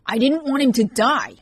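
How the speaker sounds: high, loud, pleading shout; a desperate shout to stop him. A narrator's reading. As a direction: cry it out in defense of him. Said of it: "she shouted".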